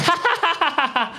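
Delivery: high staccato mock laughing; panicked fake laugh. Exposed guest covering up to host dad, panicked laugh. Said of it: "I panicked and I went".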